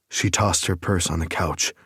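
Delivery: ordinary talk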